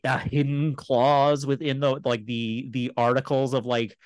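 ordinary delivery

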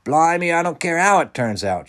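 high, loud, excited shout. A narrator's reading. Wendell won over by the island idea, shouting. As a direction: shout it, throwing doubt aside. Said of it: "shouted Wendell".

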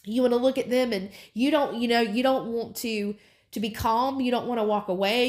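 ordinary talk, nothing marked

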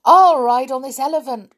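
high, loud, quick exclamation; excited cry. A narrator's reading. Joe picks his roundabout animal, excited cry. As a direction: call it out eagerly as a boy in a story. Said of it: "cried Joe".

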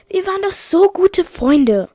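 high, loud reproach; hurt protest. Acted. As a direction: protest to a friend who betrayed you. Confronting a former friend in story.

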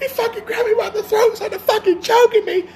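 very high squealing mock tantrum; crying, screaming kid. Host mimicking the choked kid, high screaming wail. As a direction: screech it like a hysterical child. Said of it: "The kid starts crying, screaming".

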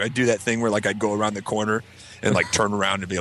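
ordinary talk, nothing marked

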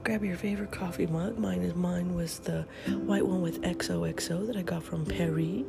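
normal talking voice

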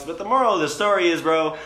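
excited. Real, not acted. Excited payoff of the story.

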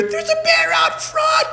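high, shrieked mock panic; freaking out. Lady alerting the monastery to a bear, hammed-up panicked shouting. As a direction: yell it hysterically, imitating a terrified visitor. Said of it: "just freaking out".

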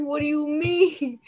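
slow, high, laughing through the words; laughing quote. Retold or imitated. To her friend while retelling, a slow laughing question. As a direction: giggle out the question.

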